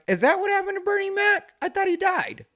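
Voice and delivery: high, fast mock astonishment; high mock surprise. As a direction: squeal it in mock shock. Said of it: "you'd be like".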